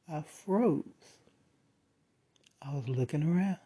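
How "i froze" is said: usual speaking voice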